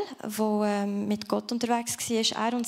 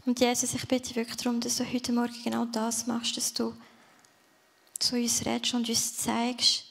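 heartfelt prayer. Real, not acted. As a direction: pray it gently to the Father. Closing prayer.

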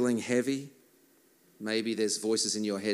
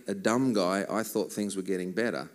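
ordinary talk

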